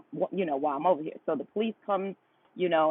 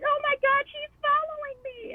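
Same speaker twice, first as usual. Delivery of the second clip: much higher, mocking imitation of a frightened caller; mock scared lady. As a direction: squeak it out like a fake-scared woman on the phone. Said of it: "now she went into she's following me".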